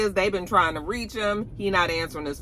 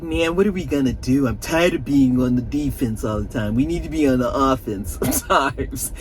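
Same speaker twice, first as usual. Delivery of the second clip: frustrated Tariq; 4 semitones lower than usual. Retold or imitated. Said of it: "Tariq was like".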